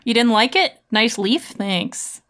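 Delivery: usual speaking voice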